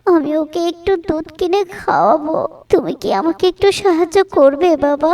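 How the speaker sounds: high-pitched woman begging for help; pleading poor mother. Acted. Poor mother asks a boy for milk money, pleading.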